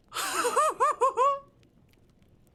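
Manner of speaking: high, very loud cackle; real laughing fit. Real, not acted. Reader cracks up at "Beautiful", real laughter. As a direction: burst out of character laughing hard.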